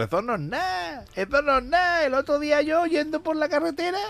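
fanfarrón contando hazaña. Acted. Chiste, segundo amigo fanfarrón.

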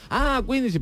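a lightly voiced Italian tourist catching on; imitating an Italian customer. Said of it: "y me dice".

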